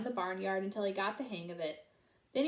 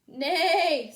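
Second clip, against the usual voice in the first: loud neigh; 6 semitones higher, 9 dB louder than usual. A narrator's reading. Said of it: "said Horse".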